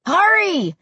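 high, urgent call; calling back. A narrator's reading. Josh to friends lagging behind, calling back. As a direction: shout back to hurry stragglers. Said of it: "Josh called back to us".

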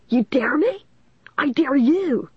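high-pitched, indignant retort; shot back. A narrator's reading. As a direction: throw the dare straight back. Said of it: "Lanny shot back".

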